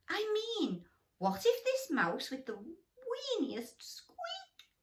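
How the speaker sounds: high, slow squeaky mouse musing; little mouse voice. Acted. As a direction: think aloud as the tiny hopeful mouse. Mouse voice continues.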